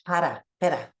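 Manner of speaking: para, pera with a single tapped r; Spanish para, pera. Shown as a demonstration. Teacher models Spanish para, pera for the class. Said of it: "In Spanish".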